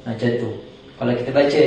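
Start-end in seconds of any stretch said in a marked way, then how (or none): none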